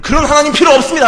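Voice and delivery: loud, high indignant dismissal; mock indignant believer; defiant retort. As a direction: toss God aside in a huff.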